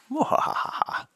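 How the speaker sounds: evil high cackle; gleeful villain laugh; mad scientist. Real, not acted. Cackling after bear prediction.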